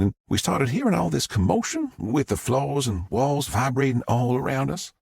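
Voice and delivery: a Southern mom gushing to the press; Southern witness. Acted. Southern witness tells story.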